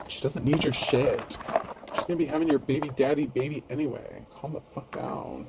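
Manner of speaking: put-on bratty teen sass; snotty teenager voice. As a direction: talk like a snotty teenage girl. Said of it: "that's also my snotty teenager voice".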